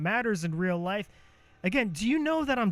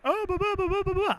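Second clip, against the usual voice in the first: much higher, singsong blah blah; silly high blah; 12 semitones higher, faster than usual. Shown as a demonstration. Streamer showing how his joking voice jumps higher. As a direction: squeak the blahs way up high. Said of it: "I even change the pitch in my motherfucking voice".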